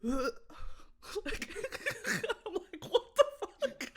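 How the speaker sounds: loud, high, laughing disbelief; cracking up, barely getting the words out; helpless laughter. Real, not acted. Owner retells the dog's retching, laughing uncontrollably.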